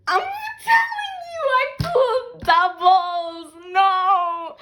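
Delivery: high, loud, drawn-out cry of dismay; distressed wail. Real, not acted. Vlogger discovering doubles in the album pulls, high dismayed wail. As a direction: wail it slowly in mock agony. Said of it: "I'm crying".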